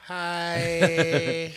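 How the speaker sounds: high breathy-style greeting; seductive hi. Pretend. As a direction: purr it out flirty. Acting the seductive agent.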